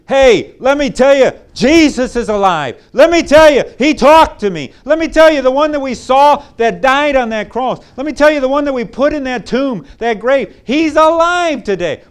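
high, loud, jubilant announcing; excited joy. Acted. Disciples telling everyone the risen Lord spoke, thrilled and loud. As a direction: burst out with the news he's alive.

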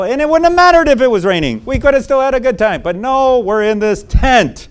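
high, loud nagging wife blaming dad; wife griping to the kids about dad's camping trip, whiny and loud; whiny complaining wife. Pretend. Whining wife blames dad to the kids, high and loud. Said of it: "She could say".

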